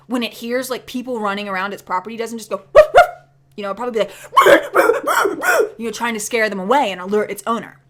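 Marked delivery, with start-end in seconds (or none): 2.7-3.0 two yappy high barks; bad dog impression. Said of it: "That was a horrible impression of a dog". 4.3-5.7 rapid high barking, loud; bad dog impression. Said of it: "That was a horrible impression of a dog".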